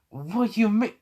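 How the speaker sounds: high, jumpy mock surprise; startled question. Man told his jacket's on fire, startled.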